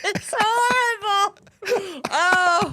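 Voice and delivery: high, slow, laughing wail; helpless amused dismay. Real, not acted. Teasing verdict on his bad accent.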